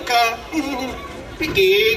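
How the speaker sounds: normal talking voice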